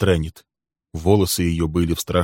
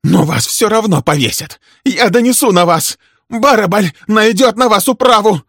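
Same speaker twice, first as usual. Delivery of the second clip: high, loud furious threats; enraged woman's tirade; shouting; 8 dB louder than usual. A narrator's reading. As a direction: scream threats at him, climbing higher. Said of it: "кричала она".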